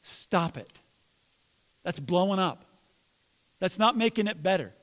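ordinary delivery

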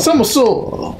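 slow high Somersault!; drawn out mock call. Friend mimicking the character's move call, slow and high. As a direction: stretch it like the game's announcer.

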